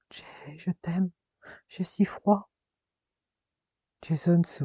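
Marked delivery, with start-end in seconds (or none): 0.1-2.5 slow, much softer, fading voice; dying whisper. Acted. A dying Marina tells Jason she loves him, fading and slow. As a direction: let the life drain out as you speak.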